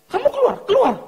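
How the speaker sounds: very high, loud squawk; mock urgent order. Pastor telling young man to quit, high mock command. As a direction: order him out in an exaggerated high voice.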